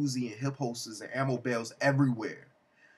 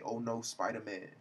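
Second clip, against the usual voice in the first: softer, subdued echo of a villain's cry; understated retold quote; 3 semitones lower, 6 dB quieter than usual. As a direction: repeat it softly to yourself, savouring it. Savours the quote in own voice.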